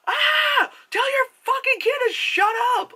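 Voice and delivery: high, loud mock-furious rant; exasperated outburst. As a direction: snap at the parents in exasperation. Annoyed at kid's parents.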